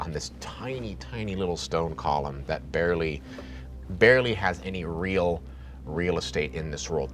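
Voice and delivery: ordinary talk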